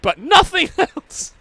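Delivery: high, slow, through laughter; laughing punchline. Retold or imitated. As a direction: giggle out the last word.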